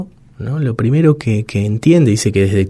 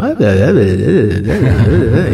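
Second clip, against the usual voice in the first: imitating baby babble. Guest imitating babies playing at speech, sing-song babble. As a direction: babble nonsense like a baby trying to talk. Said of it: "a los bebés diciendo".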